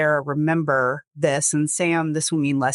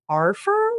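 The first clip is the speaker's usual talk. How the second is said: "Our firm?" with a steep rise, much higher on "firm"; as a question. Retold or imitated. Host retelling Sarah asking, a steep rising question. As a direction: turn "firm" into a sharp high question. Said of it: "She said it as a question mark".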